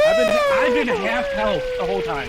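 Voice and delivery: high, loud outraged complaint; yelling in disbelief. Real, not acted. Complaining about no heals.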